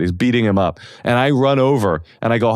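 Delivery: ordinary delivery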